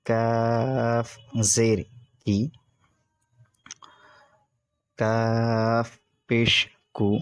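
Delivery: measured teacher recitation with long pauses; patient classroom drill; slow spelling drill. Shown as a demonstration. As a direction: spell each syllable out for students to repeat.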